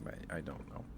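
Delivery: ordinary talk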